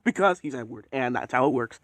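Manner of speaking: ordinary delivery, nothing marked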